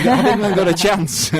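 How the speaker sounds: laughing. Real, not acted. Teasing question through a laugh.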